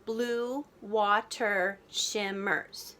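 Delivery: very slow, one beat at a time; slow syllable-counting read. Shown as a demonstration. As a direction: stretch each syllable clearly. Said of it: "count the beats of the words".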